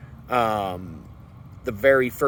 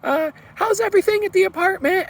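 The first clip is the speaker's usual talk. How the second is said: much higher, sugary sweet landlady voice; super friendly landlady. Retold or imitated. Landlady on the phone, suddenly sweet to her tenant, sugary high coo. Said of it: "she's all super friendly".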